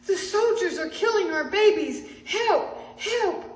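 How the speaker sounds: high, loud cry for help; shout of alarm. A narrator's reading. Someone cries out as soldiers attack, alarmed shout. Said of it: "a shout rang out".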